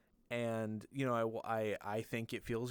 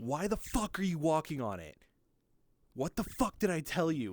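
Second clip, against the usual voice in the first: higher, exasperated telling-off; angry scolding; 4 semitones higher, 4 dB louder than usual. Retold or imitated. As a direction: imitate a doctor losing patience. Doctor's angry scolding, acted out.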